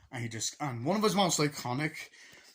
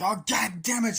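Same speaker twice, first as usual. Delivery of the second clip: louder, higher mock outcry; mock pained yell; 10 semitones higher, 10 dB louder than usual. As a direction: yell like you just got hit. Man hit on head.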